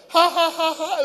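put-on high childish giggle; high mock child giggle; imitated child laugh. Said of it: "You laughing like that".

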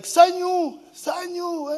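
high sung-out call across the yard; high calling of a name. Retold or imitated. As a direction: call her name from the gate, high and loud. Calling the girl at the gate.

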